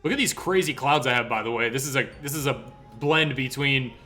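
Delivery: ordinary talk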